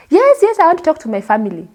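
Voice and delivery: high, quick eager yes; excited yes. Retold or imitated. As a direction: grab the chance eagerly, fast. Eager reply to phone offer.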